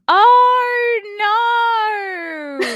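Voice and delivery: a hammed-up Australian "Oh, no" pitched very high and loud; playful bad Australian accent impression. Shown as a demonstration. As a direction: stretch "Oh, no" into a broad, mock Australian drawl. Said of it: "the only thing I can say with an Australian accent".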